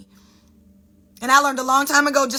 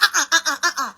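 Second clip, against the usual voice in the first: higher, hammed-up string of refusals; dramatic refusal; 4 semitones higher, faster than usual. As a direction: refuse it dramatically, syllable after syllable. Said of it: "it will be real dramatic".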